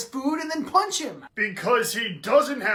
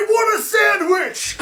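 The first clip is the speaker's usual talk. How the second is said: whiny demand; 9 semitones higher, 3 dB louder than usual. Acted. Billy demands sandwich, crying shout.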